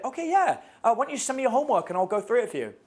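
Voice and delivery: put-on high, breezy girly voice; guy mimicking the girl assigning homework; girl's voice. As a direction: toss it off like the classmate using him. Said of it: "She's like".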